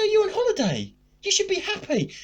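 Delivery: put-on coaxing cheer; feigned concern. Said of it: "started trying to make out I sort of".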